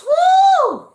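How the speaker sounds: loud, high cheer of delight; excited whoop. Real, not acted. Livestream host whooping as a viewer from Barbados joins in, excited.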